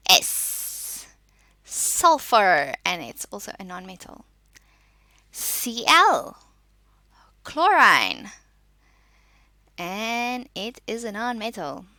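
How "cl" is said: ordinary delivery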